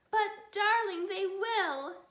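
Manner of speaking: high, loud tearful plea; sobbing plea. Acted. Fox mother sobbing to her husband. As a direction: plead through hammed-up sobs. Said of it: "sobbed Missus Fox".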